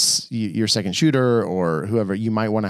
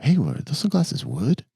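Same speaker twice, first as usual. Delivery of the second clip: higher, impressed Hollywood exec voice; impressed junior exec; 6 semitones higher than usual. Retold or imitated. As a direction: play the wowed young studio exec. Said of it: "one of those junior execs is like".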